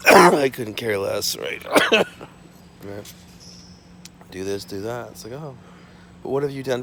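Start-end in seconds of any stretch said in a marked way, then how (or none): 0.1-1.4 loud, slow defiance; loud defiant. Real, not acted. Loud, slow defiance toward the media. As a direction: drag it out loud.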